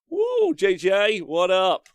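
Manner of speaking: high, loud, slow hype; excited greeting. Real, not acted. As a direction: whoop it up for a friend.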